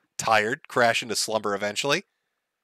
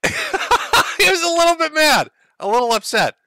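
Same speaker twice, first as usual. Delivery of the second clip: laughing; 4 dB louder than usual. Real, not acted. Laughing restatement of understatement.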